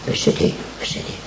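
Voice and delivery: quick whisper, voice dropped right down; fast whisper. Acted. Preacher whispering of Jesus weeping over the city. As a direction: let the words rush out under the breath.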